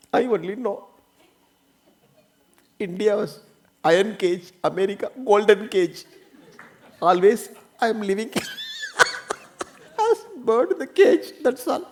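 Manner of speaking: high plaintive woman's voice with a laugh; a wife's high, half-laughing lament; complaining wife. Retold or imitated. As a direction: play the wife sighing over her golden cage. Retold wife complaining about life.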